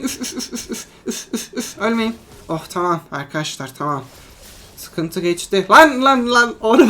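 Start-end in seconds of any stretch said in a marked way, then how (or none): 0.0-1.8 soft unvoiced puffs of breath; tense player puffing air to keep allies alive; blowing puffs. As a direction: blow on it like cooling soup. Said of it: "Üfleyin, üfleyin".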